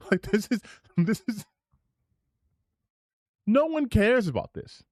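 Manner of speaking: high, fed-up whine; exasperated. Real, not acted. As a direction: groan it, completely over it. Exasperated at clip.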